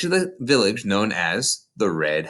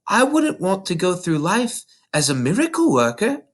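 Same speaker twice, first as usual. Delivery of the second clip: put-on lightly raised little girl voice; Matilda's voice; 8 semitones higher than usual. A narrator's reading. Narrator voicing young Matilda to her teacher, small girl voice. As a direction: voice a bright, earnest young girl.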